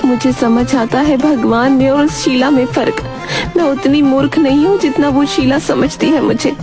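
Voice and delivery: high-pitched cow character voice; sceptical cow. Acted. Cow insists to God she is no fool, sceptical. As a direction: stand your ground as a doubting cow, pitched high.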